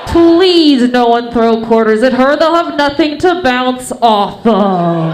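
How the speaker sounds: booming, very loud show MC; strip club announcer. Acted. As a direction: announce the next act at full volume, stretching the final words. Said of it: "It would sound a little like this".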